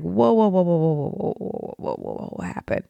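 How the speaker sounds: repeated whoas fading from full voice to a breathless near-whisper; alarmed whoa. Retold or imitated. A mother retelling her alarm on the ninth day without a call, whoas fading out. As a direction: panic dawning then trailing off.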